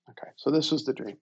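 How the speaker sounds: ordinary talk, nothing marked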